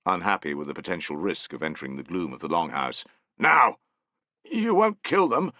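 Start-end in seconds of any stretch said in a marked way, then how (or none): 3.4-3.8 loud barked order, higher; sharp command. A narrator's reading. Soldier commands. 4.4-5.5 put-on high, slow, fearful old man; fearful plea. A narrator's reading. Frightened old man asks.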